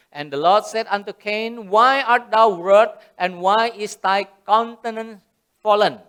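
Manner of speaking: slow, solemn King James reading; scripture reading. Acted. Pastor reading the English Bible verse, slow and solemn. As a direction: intone the old English verse slowly.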